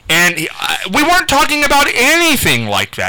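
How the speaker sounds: ordinary talk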